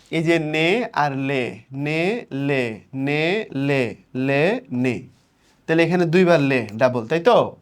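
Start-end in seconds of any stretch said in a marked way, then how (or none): 0.4-5.0 নে and লে very slow and stretched, one by one; drawn-out rhyme syllables. Shown as a demonstration. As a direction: hold each নে and লে long, with gaps. অন্তমিলের ধ্বনি দেখানো. (নে, লে টেনে টেনে আলাদা করে)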